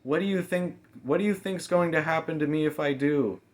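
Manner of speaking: high, loud, desperate question; girl pleading in fear; frightened protest. A narrator's reading. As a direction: demand to know her fate. Mamie voiced, frightened protest.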